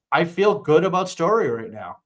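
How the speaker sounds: ordinary delivery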